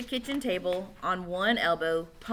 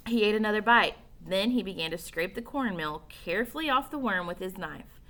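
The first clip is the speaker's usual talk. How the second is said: plain reading voice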